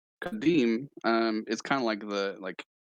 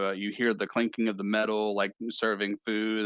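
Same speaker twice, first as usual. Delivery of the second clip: normal talking voice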